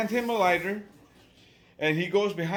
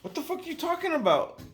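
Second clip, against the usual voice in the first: higher, quick baffled question; thrown off; 10 semitones higher, faster than usual. Retold or imitated. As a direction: retell being caught off guard. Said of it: "which throws me the fuck off".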